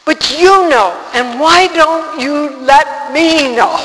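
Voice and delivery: anguished protest building to a high, loud shout; retold screaming at God. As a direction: start firm, then cry out accusingly at God. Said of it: "I was screaming at God".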